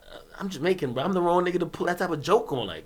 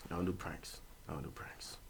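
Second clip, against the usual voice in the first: muttered; 13 semitones lower, 24 dB quieter than usual. Real, not acted. Muttered repeat to himself.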